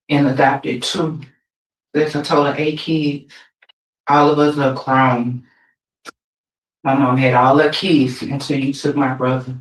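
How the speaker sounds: normal talking voice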